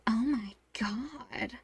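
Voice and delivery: slow, hushed mock gasp of awe; awed whisper. An gawking at the shirtless professor, slow awed whisper. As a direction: whisper it slowly, imitating someone awestruck.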